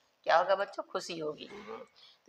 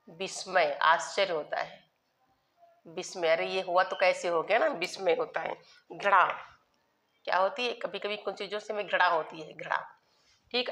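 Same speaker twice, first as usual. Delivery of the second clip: ordinary talk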